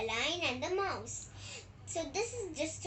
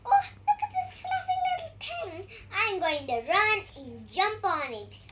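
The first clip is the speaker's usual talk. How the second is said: high, fast, playful mouse squeak; little mouse; 5 semitones higher, 9 dB louder than usual. Acted. Mouse finding the sleeping lion, high excited squeak. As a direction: giggle and scamper like a tiny mouse. Mouse puppet plays happily.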